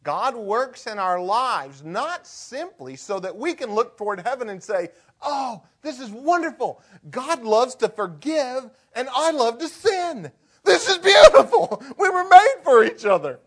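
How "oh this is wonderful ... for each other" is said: much higher and louder, laughing gleeful mockery; mock gleeful sinner. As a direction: gush like someone delighted to sin. Mocking a glib sinner.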